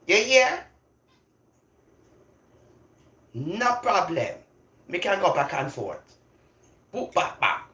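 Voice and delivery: switching into Jamaican patois. Shown as a demonstration. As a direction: lay on the Jamaican patois, playful. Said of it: "And then I can also talk like this".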